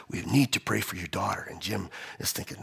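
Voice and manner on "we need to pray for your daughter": soft, low, discreet request; hushed urging. Retold or imitated. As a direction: urge it quietly, under the service. Note passed discreetly to pastor.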